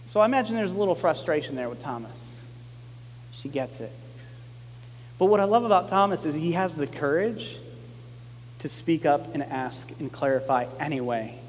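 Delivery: ordinary delivery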